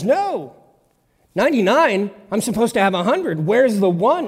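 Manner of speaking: loud, high, urgent insistence; insistent God. Acted. God refusing to lose one sheep, loud and insistent.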